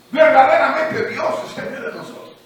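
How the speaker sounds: proclamación lenta y fuerte, más aguda; predicación enérgica. Acted. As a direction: proclama con fervor de predicador. Preaching at full force. (predicación a pleno pulmón)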